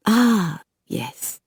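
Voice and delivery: lightly put-on French accent, curious; a hint of a French vampire sizing her up. A narrator's reading. Bouchard voiced, French accent.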